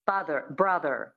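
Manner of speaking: FATHER, BROTHER, each ending in a sounded R; American rhotic R. Shown as a demonstration. Coach drilling American, father and brother with rhotic R. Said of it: "So that's the American".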